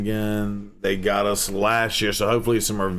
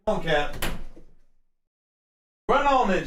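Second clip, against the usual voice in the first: calling a cat; 8 semitones higher than usual. Real, not acted. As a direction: coax the cat in sweetly.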